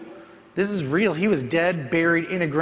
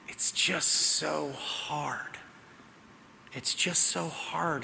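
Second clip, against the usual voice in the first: soft, slow, much quieter confession; honest lament; 10 dB quieter, much slower than usual. Retold or imitated. Wife retelling her teenage self's honest words to Jesus, softly. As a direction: say it like a tired teenager at the end of herself. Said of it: "she just says to him so honestly".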